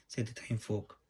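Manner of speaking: usual speaking voice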